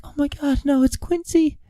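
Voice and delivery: imitating Treadwell. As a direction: squeak it out like a frightened man spotting danger. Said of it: "the way Treadwell says it".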